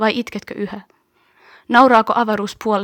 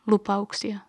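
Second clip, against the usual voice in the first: ordinary delivery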